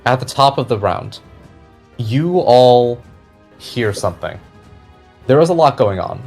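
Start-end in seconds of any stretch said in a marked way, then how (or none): none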